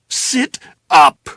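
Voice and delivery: loud barked order; loud command to a drugged woman; shout. Acted. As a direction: yell right into her face. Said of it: "I shouted into her face".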